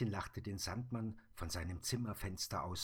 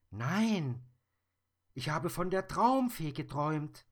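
put-on high, louder child voice; brave little girl; 9 semitones higher, 9 dB louder than usual. Little girl telling the Sandman about the monster, high and loud. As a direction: speak as a bold small girl.

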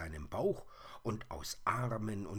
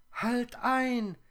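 piercingly high, loud spider chorus; loud plaintive squeal, far above natural pitch; high whirring voices; 9 dB louder than usual. A narrator's reading. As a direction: cry out as tiny complaining creatures. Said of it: "riefen sie im Chor".

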